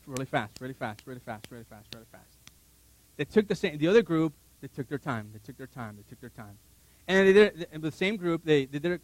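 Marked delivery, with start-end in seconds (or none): 0.1-2.4 fast repeats fading to nearly nothing; rushed. Shown as a demonstration. Speaker showing people rushing a task, rapid and fading. 4.6-6.6 low repeats trailing into near silence; unhurried, fading away. Shown as a demonstration. Speaker showing the patient group's pace, hushed and fading.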